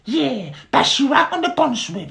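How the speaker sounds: very high, loud squeaky beetle voice; high squeaky Bart. Acted. Dim little brother greeting Barry, high squeaky voice.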